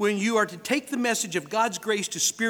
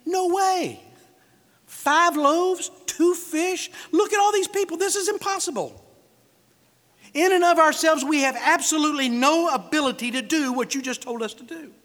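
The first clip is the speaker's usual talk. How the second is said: high, loud, exasperated objection; worked-up, high-pitched acted outburst; incredulous protest; 7 semitones higher, 4 dB louder than usual. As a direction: protest that the order is impossible. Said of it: "So they're basically saying".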